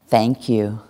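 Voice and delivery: low, understated thank you; appreciative thanks. Shown as a demonstration. Teacher thanking listeners for following a command, low appreciation. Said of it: "see if you can feel the difference".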